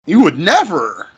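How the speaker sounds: NEVER stressed with a high pitch swoop; emphatic negation. Shown as a demonstration. Teacher shows students emphatic negation, stress on NEVER. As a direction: lean hard on NEVER, pitch jumping up. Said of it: "To be emphatic in your negation".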